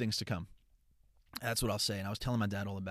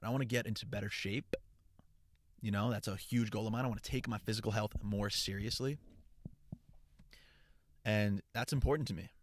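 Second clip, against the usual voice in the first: normal talking voice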